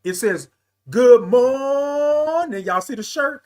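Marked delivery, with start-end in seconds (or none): 0.9-2.6 long, loud, high held MORNING; sing-out greeting, vowel held high; signature good morning. Pretend. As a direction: belt out a stretched good morning. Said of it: "that's how I say it".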